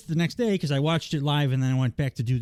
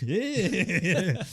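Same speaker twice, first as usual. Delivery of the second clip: laughing yeah; 8 semitones higher than usual. Retold or imitated. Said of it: "She actually started going like".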